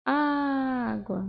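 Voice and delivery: "Água" with the opening "Á" held long; stretched stressed syllable. Shown as a demonstration. Teacher giving a spelling tip, opening "Á" drawn out in "Água". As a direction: linger on the "Á" of "Água". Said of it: "vai ser na que eu demorar mais falar".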